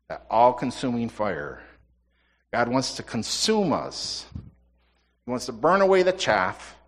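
slowed, weighty preaching; slow building sermon emphasis; slow emphatic preaching. Acted. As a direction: lean on each phrase, slowly. Preaching build on consuming fire.

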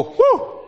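very high, loud little whoop; cartoon whew. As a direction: squeal a quick cartoon whew. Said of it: "you go".

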